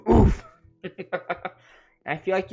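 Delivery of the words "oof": loud, higher mock game "oof!"; loud imitation of a game sound effect; imitated oof sound. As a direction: belt out the game's death "oof". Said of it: "it just screams in your ear".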